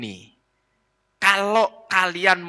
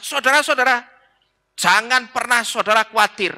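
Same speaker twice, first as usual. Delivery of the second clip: mimicking the pastor; 8 semitones higher than usual. Jemaat menirukan khotbah pendeta.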